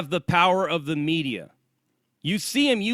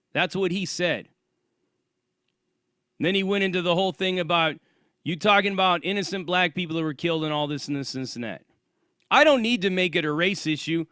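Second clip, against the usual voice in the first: usual speaking voice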